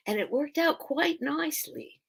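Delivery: lightly attempted Australian accent, high and soft. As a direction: have a tentative go at Australian. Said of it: "how to do an Australian accent".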